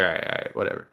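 whispered, barely there shrug; quietly shrugging off the manager's reassurance; resigned whisper. Retold or imitated. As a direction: let it go under your breath.

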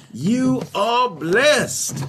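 high, slow, drawn-out proclamation; preached blessing. Real, not acted. Preacher telling each listener they are blessed, slow proclamation. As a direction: stretch each word out as a proclamation.

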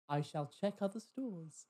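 measured, posh servility; really posh. Retold or imitated. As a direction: put on stiff posh courtesy. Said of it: "I went really posh".

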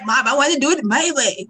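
put-on a first stab at his Southern accent; mock Southern accent. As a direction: imitate a Southern man pleading with mom. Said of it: "that's not how he said it".

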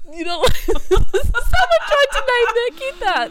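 slow, high, words through laughter; cracking up mid-sentence; laughing. Real, not acted. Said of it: "I've got the giggles".

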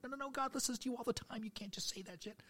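squeaky high mock panic; scandalized protest. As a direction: protest in a strained falsetto. Protesting to the church lady.

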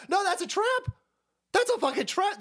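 high-pitched exaggerated alarm; alarmed mock warning. Streamer mimicking people warning of a trap, high mock alarm. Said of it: "And so they go".

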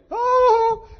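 high, slow, whiny falsetto of a hurt woman; mock offended woman. Preacher mimicking women at work calling him Scrooge, high whiny falsetto. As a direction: ham up the girls teasing him as a grump.

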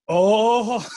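high, loud, drawn-out oh; mother's dawning realization. Retold or imitated. Mother remembering the old fight on the phone, imitated realization. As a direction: imitate mom suddenly getting it. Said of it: "I hear this".

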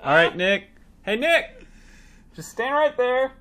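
high, loud mock stage directions; imitated director calling out. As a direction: call instructions across a set, hammed-up. Acting a director calling to Nick.

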